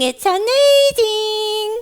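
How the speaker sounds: high, drawn-out puppet squeal; squeaky, gushing little echo; excited puppet. Acted. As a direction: stretch it out in childlike wonder, high up. Puppet echoes the host excitedly.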